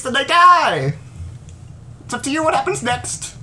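high, sing-song game host voice; squeaky showman welcoming the players; bright squeaky sing-song welcome. Acted.

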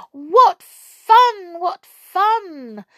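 high gleeful cheer; delighted cry. A narrator's reading. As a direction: squeal it with glee. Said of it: "cried the water".

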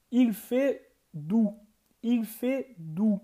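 "Il fait doux" drawn out slowly; slow French model. Shown as a demonstration. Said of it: "Está quente". ("Il fait doux" devagar, didático)

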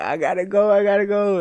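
a small child who badly needs the toilet; desperate little kid. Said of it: "as a kid, like".

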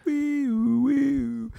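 mouthed siren. Pretend. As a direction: wail it out like a cop car. Police siren again.

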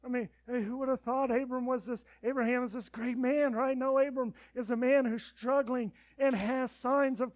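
loud, high impassioned preaching; fiery pulpit delivery; preaching at full force. Acted. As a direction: preach it out to the congregation.

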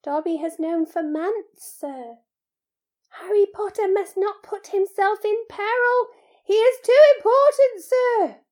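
high elf voice building loud; Dobby, urgent pleading. Acted. House-elf pleads that Harry avoid peril, urgent. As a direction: start contained, climb into a squeaky plea.

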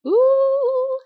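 high drawn-out ooh. Real, not acted. Reacting to something shocking.

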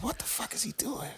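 hushed whisper of disbelief. Retold or imitated. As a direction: breathe it out in a whisper. Retold quote, unvoiced whisper.

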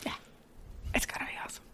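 very soft voiceless aside; excited whisper. Real, not acted. Fan blown away by the casting, whispered. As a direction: whisper it like a happy secret.

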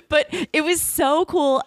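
high-pitched, slow, coming out of a laugh; real amused, drawn-out delight. Real, not acted. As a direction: speak through the tail of a giggle.

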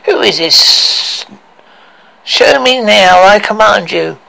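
slow, then loud commanding queen. Acted. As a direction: order the mirror imperiously.

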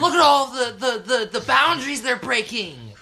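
high, loud stammering hype; gushing at the top of the voice; over-the-top excitement. Pretend. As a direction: shout it in hammed-up awe. Mock amazement at what they see.

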